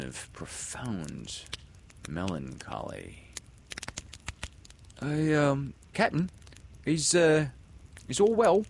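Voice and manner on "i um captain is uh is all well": high, halting, timid pirate. A narrator's reading. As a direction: ask hesitantly in a small high voice. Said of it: "they asked timidly".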